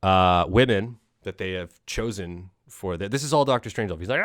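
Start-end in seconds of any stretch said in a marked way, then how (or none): none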